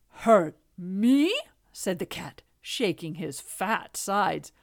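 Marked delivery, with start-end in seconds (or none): 0.1-1.3 loud, incredulous cat; scornful disbelief. A narrator's reading. Cat answers the king's warning, loudly scornful. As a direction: throw it back as a scoffing question.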